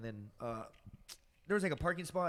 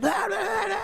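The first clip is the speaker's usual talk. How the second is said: mock emotional yelling; 11 dB louder than usual. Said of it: "all emotion".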